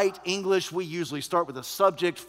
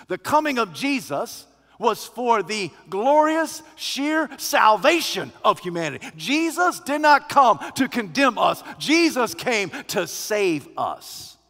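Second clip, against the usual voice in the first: higher, louder preacher's proclamation; full-force preaching; 6 semitones higher, 7 dB louder than usual. Acted. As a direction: proclaim it with rising fervour.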